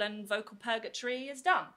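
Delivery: ordinary talk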